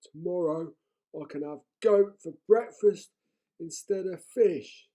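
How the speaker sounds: sleepy troll savouring the thought; contented troll. A narrator's reading. Troll dreaming of breakfast.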